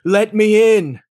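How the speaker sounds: high, loud pleading shout at the gate; loud demand. Retold or imitated. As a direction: pound on heaven's gate and demand entry.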